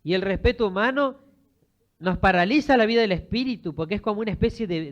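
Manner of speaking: loud, high-pitched sermon delivery; forceful preaching. Real, not acted. Preacher warning congregations about human respect, forceful preaching. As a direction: drive the warning home at full voice.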